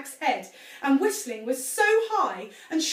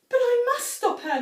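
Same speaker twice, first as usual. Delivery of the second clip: high, louder wail; 12 semitones higher, 7 dB louder than usual. A narrator's reading. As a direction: wail in distress. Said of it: "Missus Cranky wailed".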